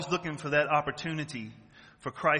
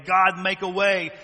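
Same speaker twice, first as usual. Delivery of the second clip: God, make a way much louder and slower; fervent loud prayer; 4 semitones higher, 9 dB louder, slower than usual. Retold or imitated. Praying for a brother, loud and fervent. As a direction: cry out to God. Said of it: "repeat builds".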